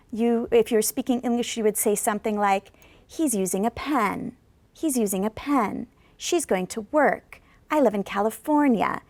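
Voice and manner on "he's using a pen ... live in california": each sentence rolls down at its end like a wave; falling intonation. Shown as a demonstration. Teacher modelling wave-like English, falling at each end. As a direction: let every sentence end fall, "pen", "work", "California" dropping. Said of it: "your intonation is falling and falling".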